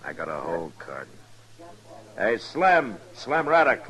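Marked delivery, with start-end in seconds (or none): 2.2-3.9 loud call across the room. Acted. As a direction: raise your voice to reach a man across the saloon. Calling across the saloon.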